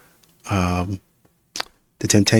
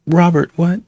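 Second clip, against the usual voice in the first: mock outrage; 7 semitones higher, 5 dB louder than usual. Real, not acted.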